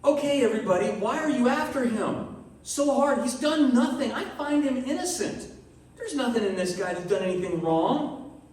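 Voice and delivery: exasperated Pilate. Retold or imitated. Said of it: "and Pilate's like".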